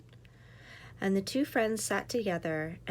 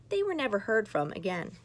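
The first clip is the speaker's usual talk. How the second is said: usual speaking voice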